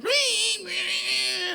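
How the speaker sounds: high, sustained electronic squeal; imitated modem screech. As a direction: squeal like an old dial-up modem. Imitates laptop dialing up online.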